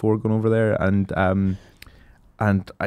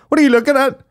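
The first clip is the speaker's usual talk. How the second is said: mum's voice; 12 semitones higher, 4 dB louder, faster than usual. Retold or imitated. Mum catches kid at computer.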